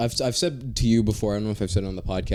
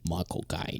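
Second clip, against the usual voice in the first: low, soft Cockney accent; Michael Caine; 5 semitones lower, 9 dB quieter, slower than usual. Shown as a demonstration. Podcaster attempting the actor's name, low Cockney impression. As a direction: drop it down into a quiet Cockney impression. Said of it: "I wish I could do the Michael Caine".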